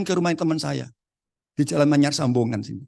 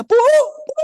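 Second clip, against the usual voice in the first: loud, very high-pitched calling out; re-enacted frantic holler; yelling for wife; 9 dB louder than usual. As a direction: shout urgently for your wife, voice way up. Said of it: "dia teriak-teriak".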